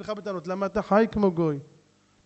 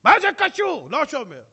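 loud, higher, prickly comeback; defensive retort; 7 semitones higher, 10 dB louder than usual. Acted. Congregant answering the rabbi about Shabbat, irritated retort. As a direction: brush the question off, annoyed.

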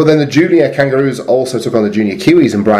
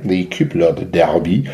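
slow, lower mock French accent; the French way, drawn out; 4 semitones lower, 4 dB quieter, slower than usual. Shown as a demonstration. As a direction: say it like a Frenchman, slowly. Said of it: "French accent, please, Mark".

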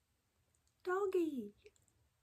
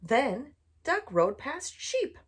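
plain reading voice

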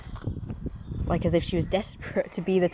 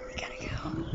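much softer whispered repeat; 8 semitones higher, 11 dB quieter than usual. Real, not acted. Owner whispers goodbye to the cat.

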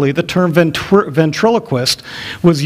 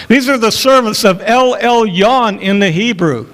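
crying out; 7 semitones higher than usual. Acted. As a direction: shout it out again, higher. Girl crying out day after day.

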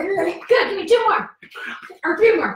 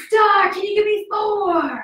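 loud coaching call; 6 semitones higher, 4 dB louder than usual. Real, not acted. Coach cheering kids through jumps.

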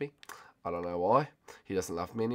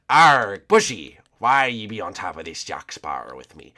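pirate; 2 semitones lower, 5 dB louder than usual. Pretend. Player asking Bushy why he's up here, pirate voice. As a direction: growl at a shipmate like a pirate.

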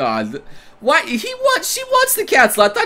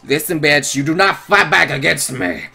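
low, held-in angry mother; subdued anger; 4 semitones lower than usual. A narrator's reading. Mother warning her defiant daughter, anger held in. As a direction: keep the anger pressed down and low. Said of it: "clearly subduing her anger in her voice".

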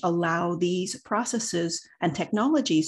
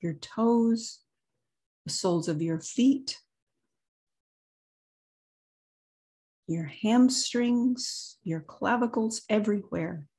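guided meditation. Acted. Leads a meditation, long pauses.

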